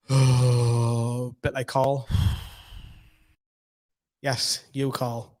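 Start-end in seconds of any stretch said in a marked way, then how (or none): 0.1-1.3 imitating a collective groan; imitated groan. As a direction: breathe out, then drone a drawn-out "oh". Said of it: "you're all gonna go".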